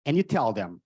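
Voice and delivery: ordinary talk, nothing marked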